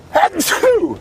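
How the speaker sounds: loud sudden sneeze; a big real sneeze; sneezing. Real, not acted. Another sneeze.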